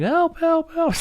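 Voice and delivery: high-pitched mock yell, repeated; imitated yelling for help. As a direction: call out like someone stuck in a bathroom. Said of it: "people hear somebody in the men's bathroom yelling".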